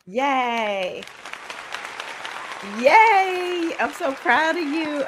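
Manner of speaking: high, proud cheering; excited cheer. Real, not acted. Host cheering Queen Barbara's teaching, excited and proud.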